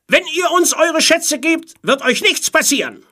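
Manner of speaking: high, loud shout; calling out. A narrator's reading. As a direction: shout terms across the water. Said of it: "rief der Seewolf". (laut hinübergerufen)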